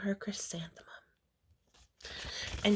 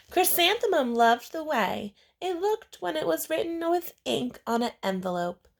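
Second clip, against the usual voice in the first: plain reading voice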